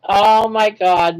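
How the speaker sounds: ordinary talk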